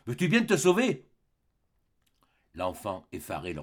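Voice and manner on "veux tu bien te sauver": loud menacing order; threatening. Acted. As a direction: scare the boy away. Threatens the child.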